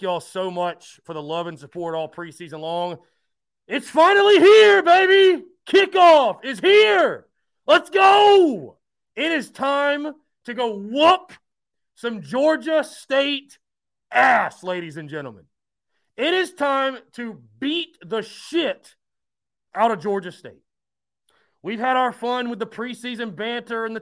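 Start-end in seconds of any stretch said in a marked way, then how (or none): 3.7-8.8 loud, high hype yell; excited shout. Real, not acted. Kickoff hype to close show. 9.1-20.6 slow, loud, drawn-out hype; hype speech. Real, not acted. Game-day hype speech.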